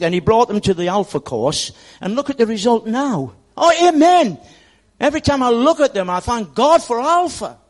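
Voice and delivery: loud, rising into a high "amen"; preaching at full force. Acted. Pastor celebrating the Alpha course, loud exultant preaching. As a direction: build to a joyful shout of praise.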